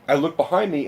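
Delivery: ordinary delivery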